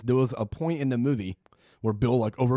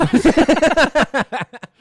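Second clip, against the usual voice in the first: loud, high burst of laughter; 13 semitones higher, 11 dB louder than usual. Real, not acted. Laughs at own remark.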